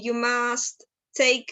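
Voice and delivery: you must take, drawn out word by word; slow model phrase. Shown as a demonstration. Teacher modelling a phrase slowly for students. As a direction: spell it out slowly for the class. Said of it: "teacher modelling the phrase".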